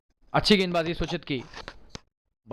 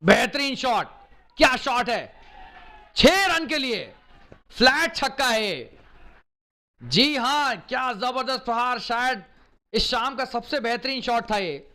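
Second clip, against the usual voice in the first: shouted, much higher commentator call; excited cricket commentary; 7 semitones higher, 4 dB louder, slower than usual. Real, not acted. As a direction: roar it out as the ball clears the rope. Commentator shouts at a flat six.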